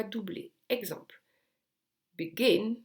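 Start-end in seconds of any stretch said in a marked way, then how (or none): 2.2-2.8 "begin" with the second syllable punched; stress on second syllable. Shown as a demonstration. English teacher showing learners the right stress, "begin" stressed on its second syllable. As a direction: lean hard on the end of "begin". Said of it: "on insiste sur le, sur la deuxième syllabe".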